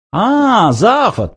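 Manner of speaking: high, drawn-out greeting; drawled. A narrator's reading. Ford refuses to be outdone by his cousin, drawling. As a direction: draw the name out, unimpressed. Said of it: "протянул он".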